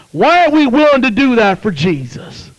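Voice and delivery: normal talking voice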